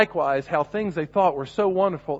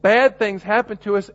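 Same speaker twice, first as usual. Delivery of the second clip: quote unquote; 4 semitones higher, 7 dB louder than usual. Shown as a demonstration. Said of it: "quote unquote".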